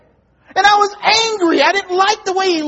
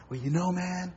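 low, soft mumbled excuse; lightly voiced down-and-out man; mumbling beggar; 12 semitones lower, 16 dB quieter, faster than usual. A hint of the beggar retelling his excuse. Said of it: "go on with the story".

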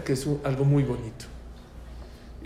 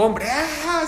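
high-pitched mock yell of joy; retold excited yelling; 13 semitones higher, 4 dB louder than usual. Father hearing the news from the doctor, high excited yelling. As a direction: imitate an overjoyed father crying out. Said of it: "empezó a gritar".

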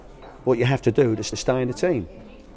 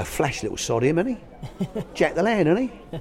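cheeky; 6 semitones higher than usual. Retold or imitated. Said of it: "Oh, cheeky".

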